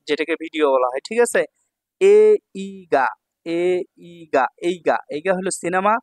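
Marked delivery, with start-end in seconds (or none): 2.0-4.5 এ ইগা very slow, each syllable held. Shown as a demonstration. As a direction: draw out এ ইগা with gaps between syllables. Teacher sounds out new word.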